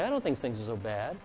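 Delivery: low, soft and mild; smiling reply. Acted. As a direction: gently counter the complainers with a smile. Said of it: "smile and say".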